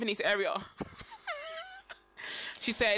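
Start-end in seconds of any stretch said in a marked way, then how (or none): 0.6-1.6 high squealing laugh. Real, not acted. Laughing at the story.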